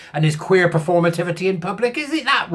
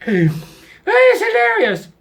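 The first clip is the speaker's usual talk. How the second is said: laughing; 4 dB louder, much slower than usual. Real, not acted. Laughing at the story.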